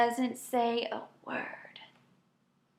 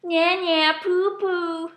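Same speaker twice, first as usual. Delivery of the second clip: taunt; 6 semitones higher, 5 dB louder, slower than usual. A narrator's reading. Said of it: "and goes".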